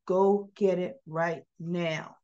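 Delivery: each word drawn out, measured; acted stern order at a slow pace; slow, firm order. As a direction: give the command slowly and deliberately.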